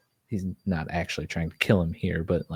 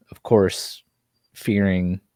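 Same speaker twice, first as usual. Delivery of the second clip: usual speaking voice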